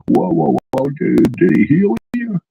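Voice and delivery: put-on an old Jesuit amazed at a healing, stretching each word; Jimmy Stewart crossed with Elmer Fudd. Old Jesuit priest asks about the healing, slow Fudd-like drawl. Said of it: "cross between Jimmy Stewart and Elmer Fudd".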